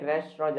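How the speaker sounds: "रेस्टोरेंट" as res-tro, silent final nt; preferred pronunciation. Shown as a demonstration. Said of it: "आप इसे आज से बोलेंगे". ("रेस्टोरेंट" को 'रेस्ट्रो' बोलें)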